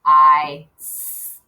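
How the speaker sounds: I then a whispered s; sounding out. Shown as a demonstration. Teacher showing how a kindergartner would spell eyes by sound. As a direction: sound out I and a hissed s. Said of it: "if I was beginning kindergarten".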